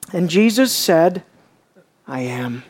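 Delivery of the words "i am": very low, soft reply; quiet answer. Acted. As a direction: answer the accusation quietly, dropping right down. Jesus answering the accuser calmly.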